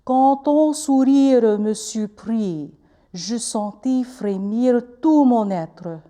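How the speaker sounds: slow, lips working on every French vowel of "Quand ton sourire me surprit"; French with rounded lips. Shown as a demonstration. Teacher showing students French lip work, slow and rounded. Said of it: "Now I'm going to read it in French".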